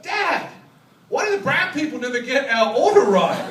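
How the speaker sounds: loud, high call from the back seat; little boy yelling. Retold or imitated. Little boy yells to Dad at the drive-thru, loud yelling. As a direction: yell it out like an impatient kid. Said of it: "my little boy at the back yells out".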